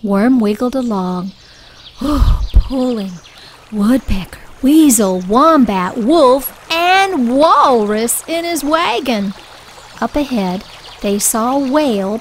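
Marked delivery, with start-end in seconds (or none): none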